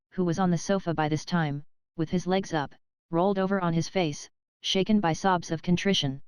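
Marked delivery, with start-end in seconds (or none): none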